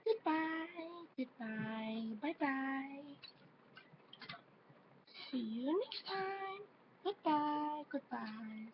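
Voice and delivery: very high, odd awkward voice. Pretend. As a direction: pitch way up in an awkward put-on voice. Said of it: "that's just an awkward voice from me".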